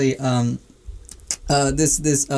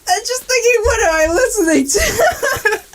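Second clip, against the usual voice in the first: dying laughing. Real, not acted. Joke teller imagines listener's dismay, helpless high laughter. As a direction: drag it out through tears of laughter. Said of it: "I'm dying".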